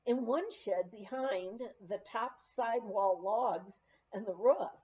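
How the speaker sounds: usual speaking voice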